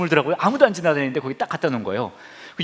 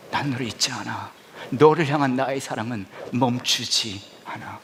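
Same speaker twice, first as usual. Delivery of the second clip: tender voice of God; 4 semitones lower than usual. Acted. God's promise to congregation.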